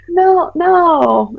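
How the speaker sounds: put-on high, drawn-out drunken mother; intoxicated denial. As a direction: imitate a tipsy parent denying it. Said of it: "are you intoxicated right now".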